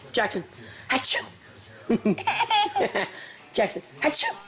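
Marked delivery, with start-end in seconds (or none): 0.9-1.3 quick mock achoo; pretend sneeze. Mother playing sneezes to amuse baby, pretend sneeze. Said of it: "when Mama sneezes". 4.0-4.4 high-pitched mock achoo; pretend sneeze. Mother playing sneezes to amuse baby, high pretend sneeze. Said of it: "when Mama sneezes".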